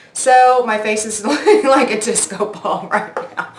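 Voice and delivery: amused, laughing through the words. Real, not acted. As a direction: crack up at yourself mid-sentence. Laughs at own disco-ball face.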